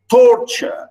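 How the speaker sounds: "torture" with its first syllable punched; stress on first syllable. Shown as a demonstration. Teacher telling students how we pronounce it, first syllable of "torture" stressed. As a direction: hit the first half of "torture" hard. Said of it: "we stress the first one".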